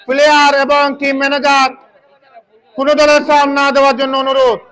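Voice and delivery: usual speaking voice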